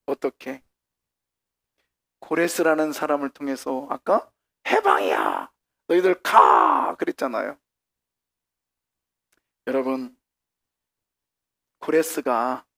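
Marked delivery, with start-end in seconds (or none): 4.7-7.0 loud announcement, ending in a drawn-out shout; proclamation shout. Acted. Preacher re-enacts Cyrus freeing the exiles, drawn-out shout. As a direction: proclaim it, then send them off loudly.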